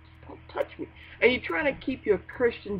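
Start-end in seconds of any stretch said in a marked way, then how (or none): 0.0-0.9 near-whispered, tight plea to be left alone; barely voiced, touchy murmur; hushed warning. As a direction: hiss it under your breath, keep them away. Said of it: "You're like".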